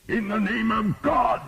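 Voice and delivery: slow, high roar. A narrator's reading. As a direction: bellow it over the battle. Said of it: "the bishop roared".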